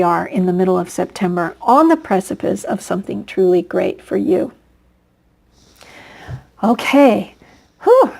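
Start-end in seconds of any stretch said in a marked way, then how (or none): 1.6-8.1 out of breath. Real, not acted. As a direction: talk while short of breath. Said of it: "I'm running out of breath".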